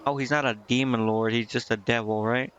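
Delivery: ordinary talk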